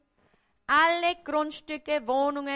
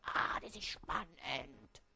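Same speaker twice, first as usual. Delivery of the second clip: whispered self-talk; 11 semitones lower, 13 dB quieter, slower than usual. Acted. Inner voice of self-deception.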